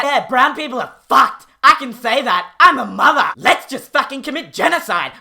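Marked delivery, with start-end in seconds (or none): 1.6-3.3 louder, smug and self-righteous; mock indignant mother; loud smug insistence. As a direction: hammer home the mother card. Mocking mother pleading authority.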